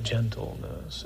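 Gentleness breathed slowly in a whisper; soft gentle whisper. Shown as a demonstration. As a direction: say Gentleness as gently as you can. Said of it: "Real manly word".

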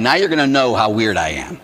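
normal talking voice

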